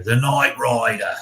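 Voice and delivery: slow, drawn-out mock Australian accent; bad Australian accent; terrible Australian accent. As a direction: put on a hammy Aussie accent, stretching the vowels. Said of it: "Australian accent".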